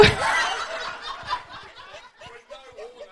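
breathless, airy laughter; silent laugh. Real, not acted. Laughing at her own joke.

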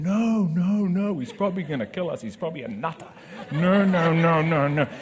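put-on high, loud, frantic teenage protest; mock panicky kids. Kids pleading with dad not to approach the stranger, mock panic. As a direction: squeal like scared kids begging dad not to.

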